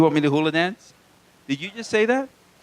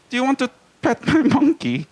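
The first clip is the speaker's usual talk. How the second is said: hammed-up German accent, pitched up; Dieter; 6 semitones higher than usual. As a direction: do a mock-German TV host impression. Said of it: "This is Dieter from S N L".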